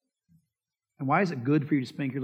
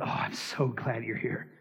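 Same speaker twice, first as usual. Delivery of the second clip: mock giddy glee; 6 semitones lower, 4 dB quieter than usual. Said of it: "He's not giddy going".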